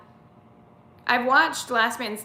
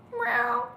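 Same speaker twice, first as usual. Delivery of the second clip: high cat meow; 13 semitones higher than usual. Pretend. Mimicking her cat.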